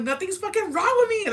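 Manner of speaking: very high, loud defensive protest; acted-out indignant outburst; mad. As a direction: yell it defensively, pitch shooting up. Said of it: "I got mad".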